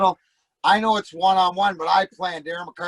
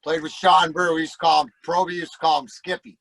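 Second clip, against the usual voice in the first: usual speaking voice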